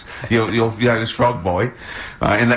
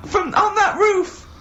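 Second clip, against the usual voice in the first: squeaky, worried bystander voice, much higher; high-pitched passerby voice. Pretend. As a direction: pitch it way up like a fretting onlooker. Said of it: "people walk along and they go".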